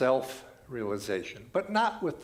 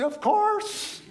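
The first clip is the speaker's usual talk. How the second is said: bright, much higher, louder exclamation; eager agreement; 8 dB louder than usual. Retold or imitated. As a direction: cheer it like it's obvious. People in India answering gladly.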